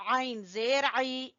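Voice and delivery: the "आइन" sound pressed from the middle of the throat; ain from the throat. Shown as a demonstration. Teacher showing pupils where "आइन" is made, from the throat. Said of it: "आइन को गले के दरमियान से".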